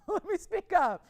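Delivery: loud, high and slow, as if speaking up; mock speaking up, loud and high; speaking up loudly. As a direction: call it out to be heard over everyone. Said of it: "let me speak up here".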